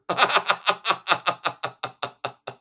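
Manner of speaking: a burst of high, loud real laughter. Real, not acted. Laughing out loud at the TV clip, a loud high laugh.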